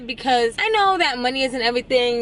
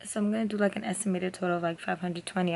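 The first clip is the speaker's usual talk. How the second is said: soft, low, exhausted voice; tired and quiet; 4 semitones lower, 11 dB quieter than usual. Real, not acted. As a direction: talk quietly, worn out after a long night. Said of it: "Excuse me if I say I'm tired".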